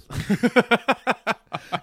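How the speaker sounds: a bright burst of laughter; laughing burst. Real, not acted. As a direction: crack up at the story. Laughs at own retelling.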